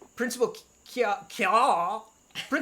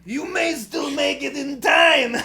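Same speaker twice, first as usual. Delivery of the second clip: Watto voice; 5 semitones higher, 6 dB louder, slower than usual. Acted. Keeps reading game lines as Watto.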